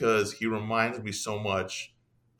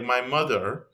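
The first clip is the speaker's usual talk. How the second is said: ordinary talk, nothing marked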